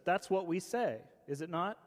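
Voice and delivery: ordinary delivery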